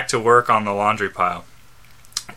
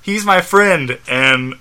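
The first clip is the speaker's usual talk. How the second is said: higher, emphatic acted plea; performed higher-voiced line; raised voice; 4 semitones higher, 4 dB louder, slower than usual. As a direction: lift the pitch and plead it. Acted breakdown dialogue, higher voice.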